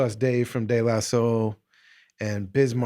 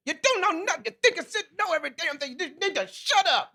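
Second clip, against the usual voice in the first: high, fast hammed-up rant; mock scolding elder; faster than usual. Host acting out Gregory berating the young comic, fast mock high rant. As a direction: rattle it off like a cranky old lecturer.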